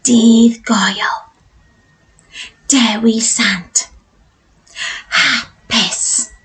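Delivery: hushed whisper, words spaced out; super quiet, drawn-out teacher demo. Shown as a demonstration. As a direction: whisper it slowly for the class to copy. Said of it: "say it in a super quiet voice".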